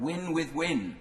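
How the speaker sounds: mild mock campaign chant; campaign cry. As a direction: recall the rallying chant with a grin. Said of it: "his campaign cry of".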